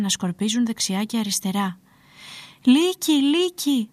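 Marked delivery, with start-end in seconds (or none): 2.6-3.9 high, urgent cry of alarm; desperate shouting. A narrator's reading. Shepherd facing real wolves, desperate storybook shout. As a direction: cry wolf, meaning it this time. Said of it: "άρχισε να φωνάζει απελπισμένος".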